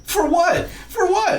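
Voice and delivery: very high, squeaky mock question; high-pitched retold cry. Retelling her reaction to his dying, high-pitched. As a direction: squeal it way up high, imitating the bewildered character. Acted punchline of retold movie joke.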